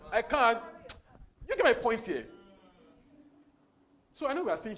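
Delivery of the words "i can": confident. Retold or imitated. Confident answer, acted.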